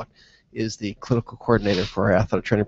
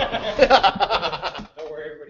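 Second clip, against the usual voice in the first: laughing; 5 semitones higher than usual. Real, not acted. After the reveal.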